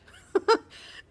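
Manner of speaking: high, loud laugh that bursts out; burst of laughter. Real, not acted. Teacher retelling the howling news, bursting into a high laugh. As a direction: laugh out at the absurdity.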